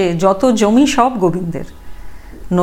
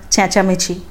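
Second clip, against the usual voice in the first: ordinary delivery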